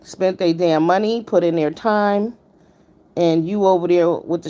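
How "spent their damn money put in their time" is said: ordinary talk, nothing marked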